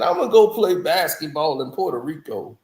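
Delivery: ordinary delivery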